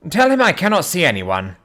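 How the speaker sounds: complaining. A narrator's reading. Master refusing a visitor from within, complaining. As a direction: complain from behind a shut door. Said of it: "it said complainingly".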